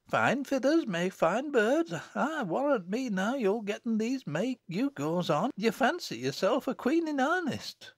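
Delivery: very high, singsong country mother; chiding mother. Acted. Mother to dressed-up daughter, high chiding voice.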